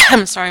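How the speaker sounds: coughing then apologizing; rough cough, then a quick sorry; chesty cough. Real, not acted. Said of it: "coughing a little bit".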